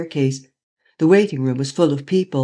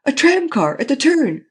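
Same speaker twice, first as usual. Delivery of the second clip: alarmed warning; 11 semitones higher, 4 dB louder than usual. A narrator's reading. Scared passenger warning.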